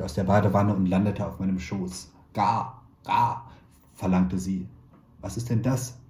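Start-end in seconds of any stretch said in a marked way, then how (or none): none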